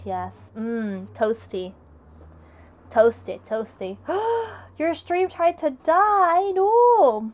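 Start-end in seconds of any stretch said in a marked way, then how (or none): 4.0-4.6 sharp high gasp; sudden shocked intake of breath. Real, not acted. Reacts to stream dying. 4.8-7.3 high, slow mock dismay rising to a cry. Real, not acted. Reacts to stream dying.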